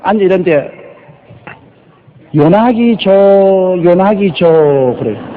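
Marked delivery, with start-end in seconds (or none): 2.3-5.0 요나기 조 as long-drawn yo-naaa-gi jooo; French mispronunciation. Retold or imitated. French people calling the pastor's name, drawn-out mispronunciation. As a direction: call out 요나기 조 with stretched vowels. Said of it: "불란서에 가니까".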